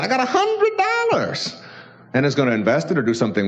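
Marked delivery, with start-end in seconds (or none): 0.0-1.4 higher, contented little boast; pleased prosperity guy. Retold or imitated. The prosperous guy counting his blessings, contented higher voice. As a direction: savor the hundred dollars you've got.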